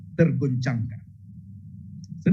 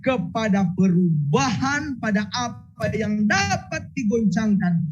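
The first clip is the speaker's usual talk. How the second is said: scripture reading; 6 semitones higher than usual. Acted.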